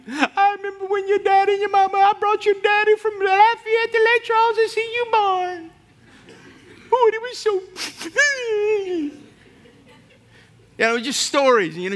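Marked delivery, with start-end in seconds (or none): 0.1-7.6 put-on much higher, louder elderly aunt voice; old aunt reminiscing. Aunt telling her nephew about his dad, high-voiced. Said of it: "she's the kind that'll talk to you". 7.7-9.1 laughing through tears. Retold or imitated. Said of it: "she starts crying".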